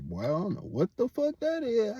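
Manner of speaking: a light imitation of being freaked out; mock startled. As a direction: play your younger self rattled, voice jumping up high. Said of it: "I'm like".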